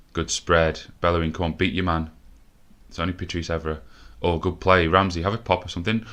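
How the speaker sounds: normal talking voice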